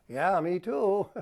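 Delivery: sarcastic. Shown as a demonstration. Husband mocking his wife's dinner plans, slow sarcasm. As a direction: stretch it out mockingly. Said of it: "That's sarcasm".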